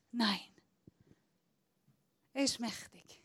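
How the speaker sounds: usual speaking voice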